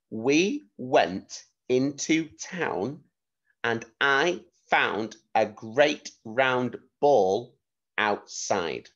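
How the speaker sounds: slow and clear, word by word; slow careful dictation for young children. Shown as a demonstration. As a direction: say it slowly so pupils can write it down. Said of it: "I'm gonna say it a few times".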